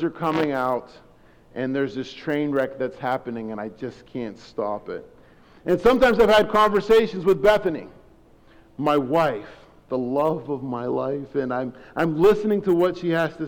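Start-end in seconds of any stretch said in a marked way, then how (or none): none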